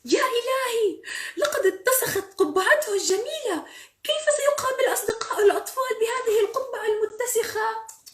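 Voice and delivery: very high, loud, horrified exclaiming; hammed-up storybook panic; dismayed outcry. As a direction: wail in alarm over a disaster. Character dismayed at dirtied hat.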